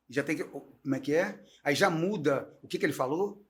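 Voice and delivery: usual speaking voice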